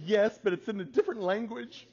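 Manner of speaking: high, loud comic wail; mock tearful protest. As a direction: imitate an upset daughter protesting. Upset daughter protests, acted for laughs.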